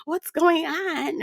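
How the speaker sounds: high, soft mock-innocent voice; clueless man. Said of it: "He looking like".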